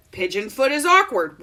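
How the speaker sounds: normal talking voice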